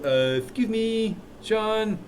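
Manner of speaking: mock student cutting in politely; a hint of a shy student raising a point; interrupting student. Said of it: "where somebody should go".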